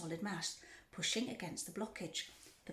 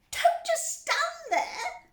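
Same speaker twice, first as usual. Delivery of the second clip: high, slow, drawn-out screech; screaming; 11 dB louder, much slower than usual. A narrator's reading. Wife to her idle husband, screaming. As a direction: scream it at your husband, stretching the words. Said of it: "Missus Gloop screamed at Mister Gloop".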